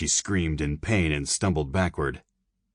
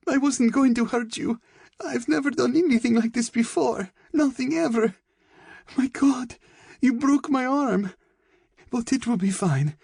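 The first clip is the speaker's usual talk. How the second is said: very high, distraught begging; frightened girl's high-pitched babbling plea; desperate pleading. A narrator's reading. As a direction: plead frantically through pain and fear. Beaten girl begging attacker to stop.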